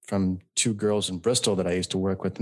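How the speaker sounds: ordinary talk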